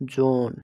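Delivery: "জোন" with a long, loud o; lengthened vowel. Shown as a demonstration. Teacher giving another long-vowel example, "জোন" with the o drawn out. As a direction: stretch the o in "জোন" before the n. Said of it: "একটু টানে পড়তে লাগে".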